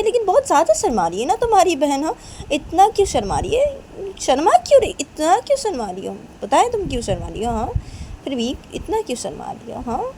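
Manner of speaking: high, loud, swooping nagging; exaggerated singsong teasing; teasing mother. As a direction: keep prodding about the shyness. Mother teases about shyness.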